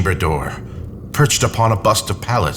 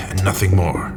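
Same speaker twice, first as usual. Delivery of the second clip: whispered reassurance to himself. Acted. Man soothing his own fear, whispered. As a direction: let it drop to a nervous whisper.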